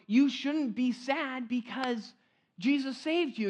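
mock pious advice. Preacher voicing a churchgoer's advice, slow and preachy. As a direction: drag it out like a sanctimonious friend.

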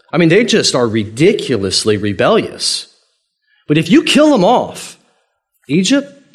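high, animated bargaining with God; playful, high-pitched appeal, imitating Moses; playful pleading. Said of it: "this playful thing where Moses is like".

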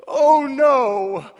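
high, loud mock groan of embarrassment; a family bracing for the worst; cringing dread. Family cringing at the boy's remark, mock dread. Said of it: "we all cringed".

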